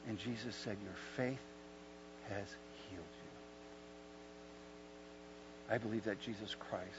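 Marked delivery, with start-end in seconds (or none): 0.8-3.3 hushed, falling away, with pauses between words; gentle pronouncement. Acted. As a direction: pronounce healing softly and unhurriedly. Jesus quietly pronounces the man healed.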